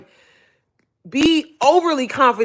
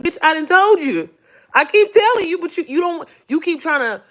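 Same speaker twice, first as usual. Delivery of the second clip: higher, louder scolding voice; God cussing; 7 semitones higher, 3 dB louder than usual. Retold or imitated. God cusses her out, higher scolding voice. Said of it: "God be cussing at me".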